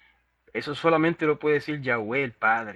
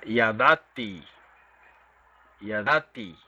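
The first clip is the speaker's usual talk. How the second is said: "yadati" with the second A stressed, ya-DA-ti; 3 semitones lower, slower than usual. Shown as a demonstration. Teacher showing the class how to stress "yadati" on DA. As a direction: say "yadati" leaning on the second syllable DA. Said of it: "en la segunda A".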